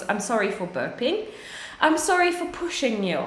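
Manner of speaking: ordinary delivery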